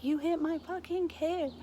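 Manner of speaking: reliving a furious scream at him. Retold or imitated. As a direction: scream it at the man who hit her son. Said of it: "I just lost it screaming".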